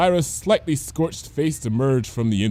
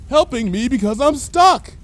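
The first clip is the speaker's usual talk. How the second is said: louder, high cheerful call; shouting; 13 semitones higher, 5 dB louder than usual. Acted. Lyra shouting through the window to the teacher. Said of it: "Lyra shouted through the window".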